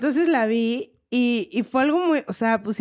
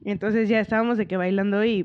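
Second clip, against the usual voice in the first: ordinary talk, nothing marked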